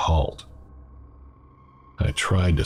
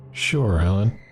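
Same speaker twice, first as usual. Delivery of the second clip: put-on a light Southern accent, lilting and higher; a lightly voiced Southern waitress gossiping; delicate southern accent; 5 semitones higher than usual. Southern waitress gossips to customer, light Southern lilt.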